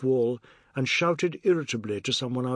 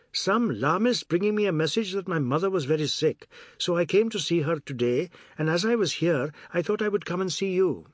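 higher-pitched, lilting character voice; excuse-making Biswas; 5 semitones higher than usual. Acted. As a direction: spin an unconvincing excuse in a higher voice. Biswas gives his made-up excuse.

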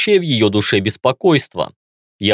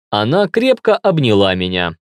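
usual speaking voice

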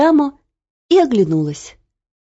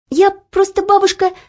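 put-on young girl voice; 12 semitones higher, 4 dB louder than usual. Olya hesitates.

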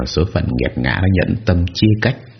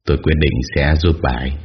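ordinary delivery

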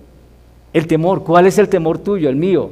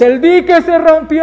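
very high, loud, sustained yell; acted mass cry, stretched out; crowd shouting; 9 dB louder than usual. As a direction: belt it out like a whole crowd in alarm. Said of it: "todos gritando a, a una sola voz".

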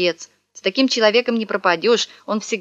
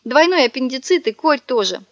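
fake crying; 6 semitones higher than usual. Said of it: "заревел".

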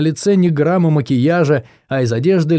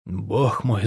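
soft shaky gasp of pleasure; trembling; 4 semitones lower, 7 dB quieter than usual. A narrator's reading. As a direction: let the words tremble softly. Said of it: "задрожала она". (дрожащим голосом)

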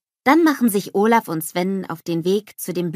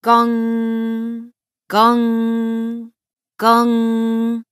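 long, level, sung-out gong strokes; imitated bell gong; 3 semitones higher, 5 dB louder, much slower than usual. A narrator's reading. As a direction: ring out like a bell, holding each note.

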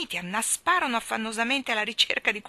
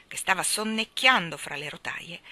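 usual speaking voice

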